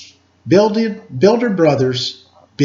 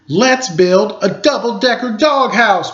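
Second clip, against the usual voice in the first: higher, excited announcement of the plan; cried out; 8 semitones higher, 4 dB louder than usual. Acted. The brothers announce their doghouse plan, excited cry. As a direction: shout the idea with joy. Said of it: "cried the brothers".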